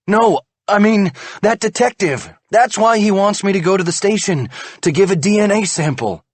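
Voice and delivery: high, loud anxious explaining; anxious explanation. A narrator's reading. Husband explains detective wants DNA, anxious. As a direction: explain in a rush of worry.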